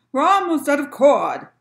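loud, high captain's alarm; storybook shout of warning; cried warning. A narrator's reading. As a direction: call out urgently as the captain. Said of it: "cried the captain".

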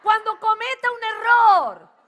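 loud, slow, high declaration; loud preaching call. Real, not acted. Preacher leading the congregation in a loud slow vow. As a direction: proclaim it to the whole room. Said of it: "Levantá las dos manos y decí".

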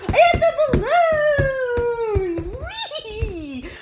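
high, loud, thrilled squeal; excited squealing. Real, not acted. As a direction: shriek with pure joy. Shrieking over a free balloon.

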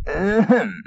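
attention-seeking ahem. Acted. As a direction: clear your throat for attention. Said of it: "ahemming over the fence".

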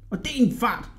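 high, soft-edged mock yell; boy's shouted order. A narrator's reading. Hodja hurrying the carpet down, mildly shouted. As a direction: command it in a high, restrained cry. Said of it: "råbte Hodja".

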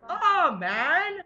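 loud angry yell at brother; angry shouting. Real, not acted. Yelling at brother off camera.

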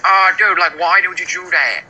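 ordinary talk, nothing marked

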